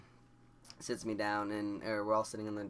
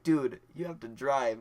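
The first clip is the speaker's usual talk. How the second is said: slow, louder teasing drawl; mock teasing friend; 5 semitones higher, 8 dB louder, slower than usual. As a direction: tease him slowly and loudly. Friend teases him while high.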